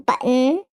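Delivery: "button" with the double T swallowed; Michigan dropped T. Shown as a demonstration. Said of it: "don't pronounce our double letters".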